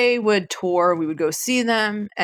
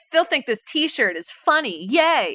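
mock girlish glee; 8 semitones higher, slower than usual. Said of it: "I was like".

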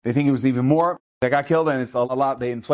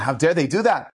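usual speaking voice

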